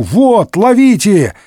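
high, urgent cry; calling out. A narrator's reading. D'Artagnan throws help to Porthos, calling out. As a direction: shout it as you throw a lifeline. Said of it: "крикнул Д'Артаньян".

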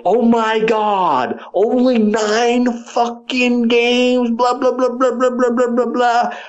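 high, slow mocking whine; sneering mock outrage at the nine-game list; mocking whiny fans. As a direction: drag it out, mimicking the complainers. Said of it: "and people were like".

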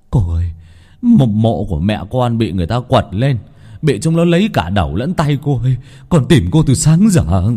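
distressed outcry; distraught villager. Acted. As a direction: plead urgently for help, voice up. Villager reports mother's desecrated grave.

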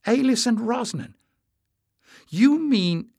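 surprised realisation. A narrator's reading. Realising the old legend.

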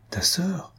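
whispered aside, breath only. Acted. Whispering the sister's name to her husband. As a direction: let it slip out as a hushed whisper.

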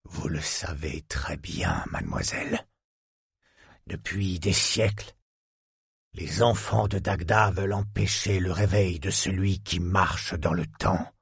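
low, soft sinister murmur; low villain whisper. A narrator's reading. The villain answers the young woman about the children, low sinister murmur.

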